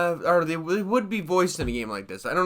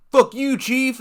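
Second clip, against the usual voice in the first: mock rude game hero; 9 semitones higher, 8 dB louder than usual. Dialogue option said as rude reply.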